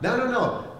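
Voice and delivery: normal talking voice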